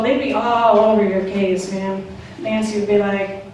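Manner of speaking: ordinary delivery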